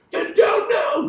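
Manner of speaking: a sudden high, loud yell of an order; mock shout. Actor in a war scene, yelling for cover under gunfire. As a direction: bark it like soldiers under fire. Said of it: "Unless it's like".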